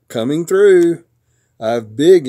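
loud, self-important dump truck; yelled. A narrator's reading. Dump truck has important things to do, yelling. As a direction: call it out to clear the road. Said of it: "yelled a dump truck".

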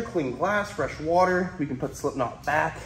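ordinary talk

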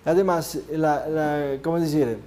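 usual speaking voice

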